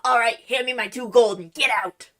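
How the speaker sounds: loud, brusque medieval tavern wench. Acted. Tipsy wench demanding gold for a haircut, tavern wench. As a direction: play a rough barmaid-barber, loudly dismissing a customer. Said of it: "like a medieval, like, tavern wench".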